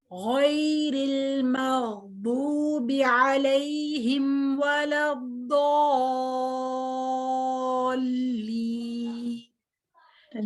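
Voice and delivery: very slow recitation, गैरिल with a thick heavy ghain. Shown as a demonstration. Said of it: "गॉइन को मोटा पढ़ना है".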